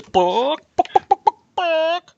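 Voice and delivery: loud, high bawk and staccato clucks; chicken clucking. Acted. Chicken repeats its demand to a staring librarian, clucking. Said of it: "and it says".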